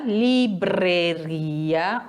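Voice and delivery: livraria, very slow, with a vibrating R; Spanish pronunciation. Shown as a demonstration. Teacher modeling Spanish R for students. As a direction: draw out livraria and roll the R. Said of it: "olha as vibrações dos R's".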